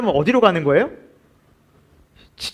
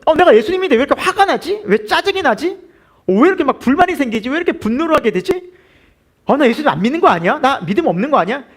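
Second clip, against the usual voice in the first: higher, louder fretting self-talk; fretful believer; 8 semitones higher, 3 dB louder than usual. Acted. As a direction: play a believer anxiously questioning himself. Acted inner voice of believer.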